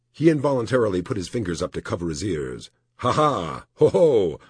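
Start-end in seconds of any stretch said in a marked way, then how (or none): 3.0-4.4 great bursting laugh. A narrator's reading. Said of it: "Great burst that made him wince".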